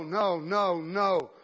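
ordinary talk, nothing marked

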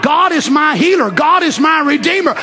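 high, shouted confession of faith; out loud. Shown as a demonstration. Preacher urges congregation to confess aloud, shouting. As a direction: shout it out as a bold declaration. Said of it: "Say it out loud".

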